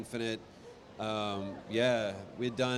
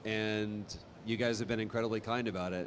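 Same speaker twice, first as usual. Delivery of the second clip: normal talking voice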